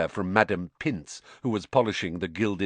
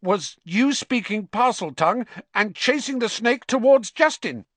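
stubborn; 13 semitones higher, 5 dB louder than usual. A narrator's reading. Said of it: "said Ernie stubbornly".

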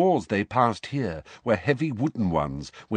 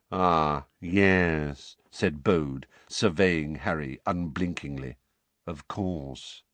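lower, slow mournful voice; mournful wizard; 5 semitones lower, slower than usual. A narrator's reading. Bode answers Arthur in a low mournful voice. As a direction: speak like a gloomy gravedigger.

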